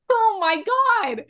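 high, slow disbelieving gasp of words; shocked oh my God. Real, not acted. Podcast host reacting to the shocking episode, stunned and high. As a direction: let the shock stretch it out.

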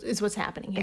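hushed conspiratorial whisper. Acted. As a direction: lean in and whisper it. Conspiratorial aside about cocktails.

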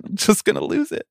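losing it, up high; exasperated high cry. Real, not acted. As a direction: voice jumps high in despair. Despairing Mets fan venting.

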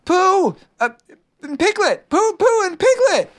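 high, thrilled squealed call; excited squeak. A narrator's reading. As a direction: squeak out the names to friends below. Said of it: "Something very excited ... and squeaked".

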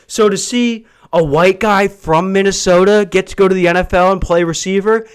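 ordinary delivery

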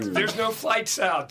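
high, slow disbelief; slow and high, stunned; incredulous quote. Retold or imitated. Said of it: "I'm like".

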